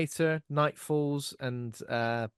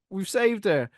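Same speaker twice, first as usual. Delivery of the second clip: high, louder call across water; well-meaning mock shout; 8 semitones higher, 6 dB louder, slower than usual. Rescuers telling the college kids she is safe, high mock shout. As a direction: call out the good news, high and loud. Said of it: "it sounds like this".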